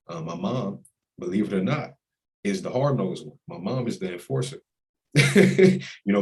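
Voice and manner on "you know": high, loud burst of laughter; laughing about his strict mom. Real, not acted. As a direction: laugh it out fondly.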